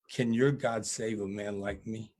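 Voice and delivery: ordinary delivery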